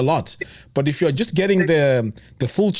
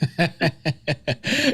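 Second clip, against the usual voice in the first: real laugh; amused laugh; 2 semitones higher than usual. Real, not acted. Interviewer hears of overnight business luck, amused laugh. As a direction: burst out laughing in recognition. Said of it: "do you know why I'm laughing".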